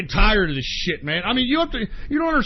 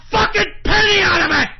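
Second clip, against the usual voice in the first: raised, building to a yell; frustrated shout; 12 semitones higher, 7 dB louder than usual. Real, not acted. Broadcaster earning nothing from the show, frustrated high shout. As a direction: erupt in real exasperation. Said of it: "It's fucking frustrating".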